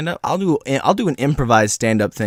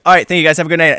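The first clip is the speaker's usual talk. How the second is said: louder, higher stage farewell; mock stand-up sign-off; 3 semitones higher, 6 dB louder, much faster than usual. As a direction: sign off to the audience like a comic. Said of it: "And then I'm like".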